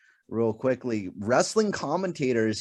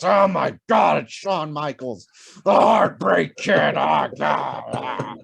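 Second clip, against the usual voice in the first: imitating JR's slow, loud announcer yell; drawn-out, louder ringside shout; excited commentator yell; 3 semitones higher, 4 dB louder, slower than usual. Imitating JR calling Shawn Michaels's entrance, slow and loud. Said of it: "J R would be like".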